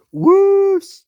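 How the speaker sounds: high, loud whooshing rush of steam; big shrieking whoosh. Acted. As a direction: blast out a whoosh like a train leaping. Engine jumping, sound effect.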